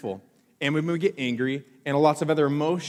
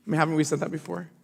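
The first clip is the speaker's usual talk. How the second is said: usual speaking voice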